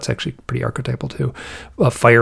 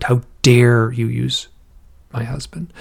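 slow retold anger. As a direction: stretch the words in mock outrage, then drop off. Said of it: "but she was angry".

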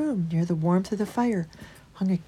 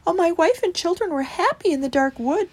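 high little mouse voice; a touch of sorrow, pitched high; mildly sad mouse; 9 semitones higher, 4 dB louder than usual. A narrator's reading. Christopher telling Holgate of his family, high and a little sad. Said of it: "said Christopher sadly".

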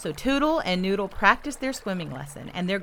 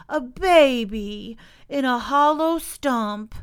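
slow, high, loud amazement; cried out; 5 semitones higher, 6 dB louder, slower than usual. A narrator's reading. Young beaver finding a baby in the stump, crying out. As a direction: stretch out the astonishing news. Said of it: "cried Toodle".

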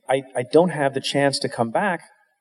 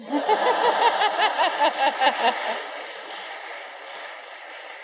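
big laugh; 3 dB quieter than usual. Real, not acted. Laughing at daughter's drama queen quote.

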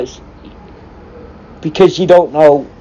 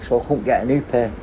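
ordinary delivery

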